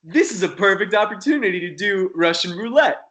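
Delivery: excited about a reckless stunt; bright idea. Retold or imitated. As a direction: sell the dangerous idea with glee. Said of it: "So it was like".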